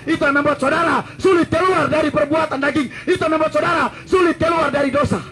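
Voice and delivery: rising, pressing preacher's cry; insistent preaching. Acted. Preacher warning the congregation, pressing preaching. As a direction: drive sulit home twice.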